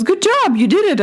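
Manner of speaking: a hint of a proud young son; son's praise. Son cheering his mom after her flip turn, lightly voiced.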